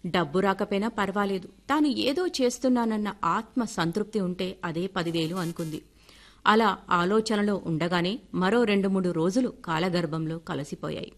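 ordinary delivery, nothing marked

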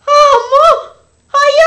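high anguished wail, breaking into a cry; wailing in grief. Acted. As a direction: cry out as the news lands. Wife learns husband burned.

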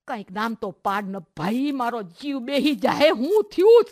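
a frightened mother begging her daughter to speak; anxious pleading. Acted. Mother begs crying daughter to explain, rising panic.

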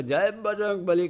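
high pitched rallying call; jumping in with a war cry; battle cry. Retold or imitated. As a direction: cry it out as you leap.

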